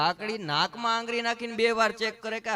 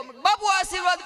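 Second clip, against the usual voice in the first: much higher, louder begging for a blessing; a devotee calling out to a sadhu; pleading devotee; 13 semitones higher, 7 dB louder than usual. Retold or imitated. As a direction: beg the holy man for a blessing.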